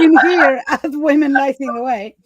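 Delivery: talking through real laughter; laughing. Real, not acted. Amused by her own quip.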